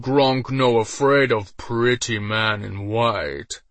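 slow, loud brutish ogre. A narrator's reading. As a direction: growl it like a dumb brute. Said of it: "The ogre screamed in fury".

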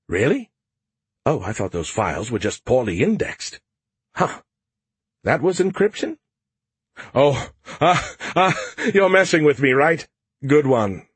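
high, teasing AI voice; Skippy voice. Acted. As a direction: act surprised, then tease. Skippy mocks the officials.

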